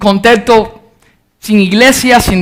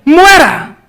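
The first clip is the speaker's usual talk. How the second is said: a high shouted cry of the crowd; mob shouting; 5 semitones higher than usual. Retold or imitated. Preacher voicing the crowd calling for Paul's death, shouted. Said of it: "Eso es lo que la multitud decía".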